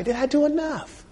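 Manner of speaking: a high, hushed cry of doubt; anguished self-question. Acted. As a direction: let it crack upward in doubt. Preacher's self-question climaxes, anguished.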